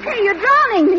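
high, loud alarmed cry; panicked protest. Acted. Woman being dunked protests in panic. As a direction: yelp as you're pushed under. Being held underwater by him.

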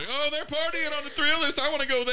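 loud, high party cheer; excited. Pretend. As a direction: hype up the party. Mock party reaction, can opened.

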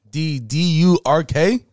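spelling the name back to his friend. Shown as a demonstration. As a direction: spell it out to check. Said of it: "I thought I would make sure".

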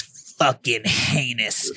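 low, soft, very slow disgust; disgusted mutter. Retold or imitated. Recruit judging him at the stall, slow disgusted mutter. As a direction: drag out the disgust, quietly.

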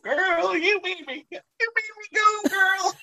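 high thrilled fan squeal; squealing excitement. Retold or imitated. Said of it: "And I'm like".

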